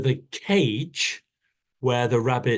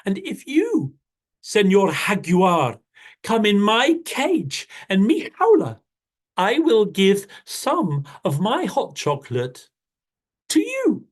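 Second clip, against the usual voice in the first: higher, quicker small rabbit voice; a clever bunny bargaining brightly; little rabbit; 7 semitones higher than usual. Acted. Rabbit tempting jaguar with hot chocolate, high and quick.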